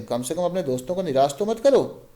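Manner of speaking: ordinary talk, nothing marked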